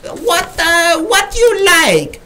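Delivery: high, slow mock Chinese accent, drawn out; a hammed-up Chinese accent imitation. Bartender greeting the customer in mock Chinese accent. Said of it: "He looks at me and he says".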